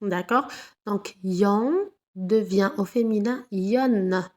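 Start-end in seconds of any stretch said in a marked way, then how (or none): 2.2-4.2 the final "yon" said with the N sounded; slow sound demo. Shown as a demonstration. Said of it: "Doublé ou le N".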